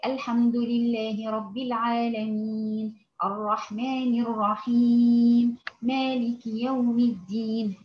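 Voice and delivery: "الحمد لله رب العالمين" at a steady, moderate pace; not slow, not rushed, just even; moderate recitation. Shown as a demonstration. Teacher modelling the moderate middle speed. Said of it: "هو ده الإيه؟ التدوير".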